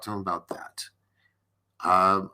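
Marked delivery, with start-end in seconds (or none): 0.0-0.8 hushed aside, voice gone; whisper. Real, not acted. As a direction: drop to a soft confiding whisper. Drops to whisper on repeat.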